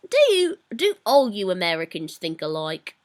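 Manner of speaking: British attempt slipping into Australian; Australian-sounding accent. Shown as a demonstration. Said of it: "That sounds kind of Australian almost".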